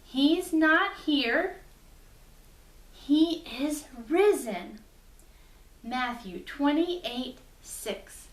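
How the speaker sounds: slow, clear, word by word; slow recitation. Shown as a demonstration. Teacher slowly models the memory verse for children. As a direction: spell it out slowly for the kids to copy. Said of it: "So it goes like this".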